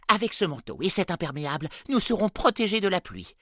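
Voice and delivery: high, loud and hurried; urgent. A narrator's reading. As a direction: rush everyone out the door. Elf urges hurry in rain.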